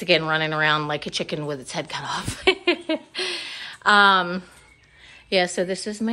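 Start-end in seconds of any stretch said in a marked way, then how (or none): 2.5-3.8 high laugh. Real, not acted. Laughs after chicken remark.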